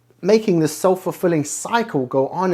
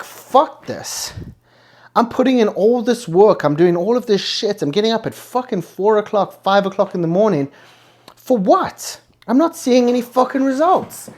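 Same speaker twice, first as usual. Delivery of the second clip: higher, exasperated inner rant; fed-up rant; 6 semitones higher than usual. Retold or imitated. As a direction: vent like you're sick of the grind.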